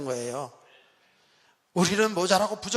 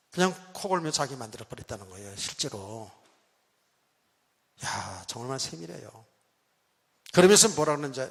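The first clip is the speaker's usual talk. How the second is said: ordinary talk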